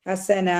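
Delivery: ഹസന ending on a long aa; no n at the end of ഹസന, held open vowel; full-stop madd. Shown as a demonstration. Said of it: "ഹസന എന്ന് പറഞ്ഞിട്ടാണ് അതിനെ full stop".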